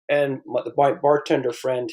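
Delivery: ordinary delivery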